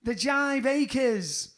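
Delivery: high, loud, slow TV presenter announcement; Keith Chegwin. Retold or imitated. The presenter calls out the band name on Sky Search, slow and loud. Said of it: "Keith Chegwin going".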